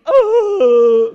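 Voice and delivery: loud, high, drawn-out "oh"; hammed-up wail of sympathy; exaggerated pitying moan; mock pitying wail. Mock display of the requested pity.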